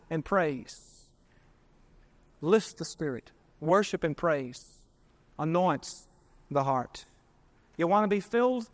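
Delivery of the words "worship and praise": normal talking voice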